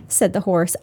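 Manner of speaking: ordinary delivery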